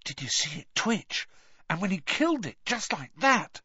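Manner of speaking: high-pitched awed pupil; awed chatter, rising to the end. A narrator's reading. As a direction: marvel at it, pitch climbing phrase by phrase. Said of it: "discussing the curses in awed voices".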